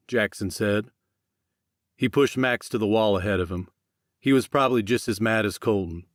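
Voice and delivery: plain reading voice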